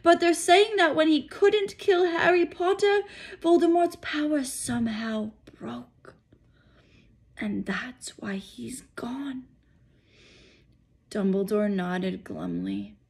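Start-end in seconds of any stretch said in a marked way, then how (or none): 0.0-6.1 high, hushed rumour-telling; high gossiping hush; gossipy hushed voice. Acted. Gossip whispered by a character. 7.4-9.5 slow, soft whisper; slow hushed whisper. Acted. Gossip whispered by a character.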